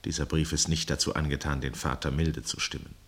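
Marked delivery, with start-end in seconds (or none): none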